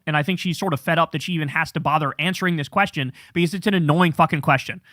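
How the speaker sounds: ordinary talk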